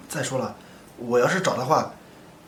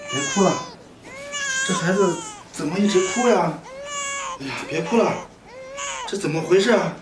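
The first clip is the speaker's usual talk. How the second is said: raised, higher-pitched fretting; helpless fretting; 9 semitones higher, 5 dB louder than usual. Acted. Lone man unable to care for a crying baby, helpless. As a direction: fret aloud over a baby who won't stop.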